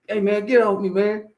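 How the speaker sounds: put-on high, squealing kid's protest; imitated pleading. Child pinned, pleading to be released.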